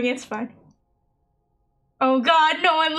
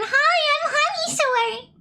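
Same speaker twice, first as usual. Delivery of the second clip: very high, squeaky cartoon voice; Honey Star impression; 14 semitones higher than usual. Mocking a friend's impression of Honey Star, very high. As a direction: squeak it like Minnie Mouse. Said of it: "Honey Star impression".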